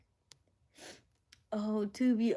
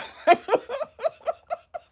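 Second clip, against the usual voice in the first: laugh; 12 semitones higher, 11 dB louder than usual. Real, not acted. Cracking up at the chat joke.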